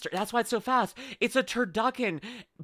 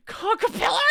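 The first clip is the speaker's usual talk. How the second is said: squealed joke; 14 semitones higher than usual. Real, not acted. Laughing outburst.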